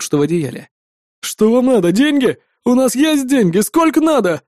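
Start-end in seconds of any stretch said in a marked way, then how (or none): 1.2-4.4 high, anxious bargaining voice; panicked yelping. A narrator's reading. Captive offers money to save himself, panicked yelping. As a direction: plead higher with each question. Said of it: "завопил тот".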